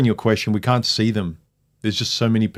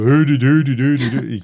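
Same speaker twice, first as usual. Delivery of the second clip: put-on hooting Dutch-accent gibberish, louder; sing-song nonsense imitation of a Dutch accent; mock Dutch English; 4 semitones higher, 5 dB louder than usual. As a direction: parody Dutch English as a run of hoo-doo syllables. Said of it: "Dutch English sounds very different".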